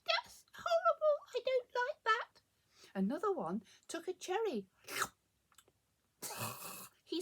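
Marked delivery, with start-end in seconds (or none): 0.1-2.4 very high, loud squeaky rabbit; disgusted space rabbit. A narrator's reading. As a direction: squeak your disgust at a nasty taste. Rabbit rejects the muffin.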